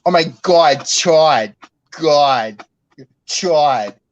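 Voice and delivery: put-on loud Worcester Massachusetts accent, no r at the end of there. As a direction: yell at Chad in a thick Worcester accent. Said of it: "I don't talk like that".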